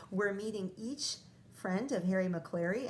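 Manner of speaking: ordinary talk, nothing marked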